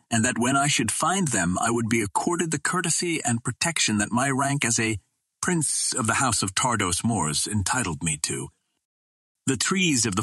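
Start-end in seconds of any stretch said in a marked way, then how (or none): none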